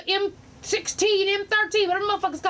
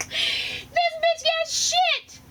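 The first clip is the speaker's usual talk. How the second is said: much higher, very slow and stretched; stunned drawn-out disbelief; 12 semitones higher, much slower than usual. Real, not acted. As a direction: stretch every word in shocked disbelief. Stunned at the sight.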